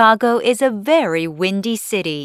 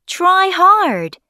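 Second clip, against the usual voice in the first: high, loud urging; urgent shout; 10 semitones higher, 5 dB louder than usual. A narrator's reading. Father urging son to reach him, urgent shout. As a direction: shout encouragement with real urgency.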